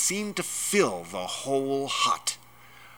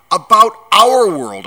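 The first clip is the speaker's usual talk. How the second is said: loud, high, impatient correction; giant's booming impatience; exasperated shout; 11 semitones higher, 14 dB louder than usual. A narrator's reading. Exasperated giant loudly corrects boy.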